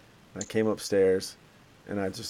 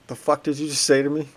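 higher-pitched, retold indignant comeback; mock-indignant, pitched up; affronted retort; 6 semitones higher, 3 dB louder, faster than usual. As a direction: retell it as an offended challenge.

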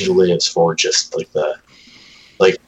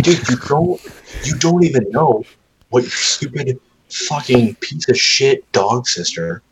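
exasperated rant; 7 semitones higher than usual. Retold or imitated. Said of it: "I'm like".

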